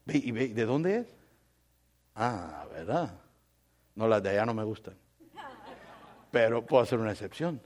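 low, hushed mock-casual questions; sly interested suitor. Preacher acting a man inquiring about a girl, hushed. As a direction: play a suitor murmuring his doubts, very soft.

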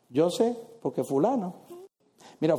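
normal talking voice

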